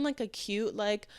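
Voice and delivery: normal talking voice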